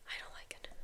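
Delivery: whispered aside to herself; hushed, unvoiced dread; whispered worry. Real, not acted. Reacting quietly to the show.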